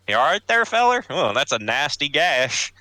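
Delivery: high Southern twang, fussing over a wounded man; a kindly Southern gent checking the wound; Southern accent. Acted. Gallant to the dying pirate, high Southern accent. Character comforts wounded waterlogged.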